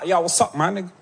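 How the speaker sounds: American cousin, lightly imitated; American accent. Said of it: "En américain dans le texte, très bel accent". (imitation légère du cousin américain, accent américain)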